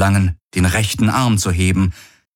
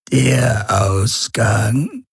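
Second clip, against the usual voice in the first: slow, drawn-out eerie question; alien voice; 4 semitones higher, much slower than usual. A narrator's reading. Urus Khan asks over the comm, slow eerie voice. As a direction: let the word hang, otherworldly. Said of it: "die Stimme des Urus Khan".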